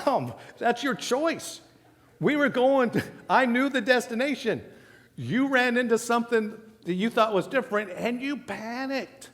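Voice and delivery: patient son. Retold or imitated. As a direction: explain it patiently to a panicking mother. Son lecturing his mom.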